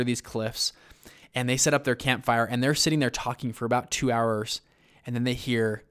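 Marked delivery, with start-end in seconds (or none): none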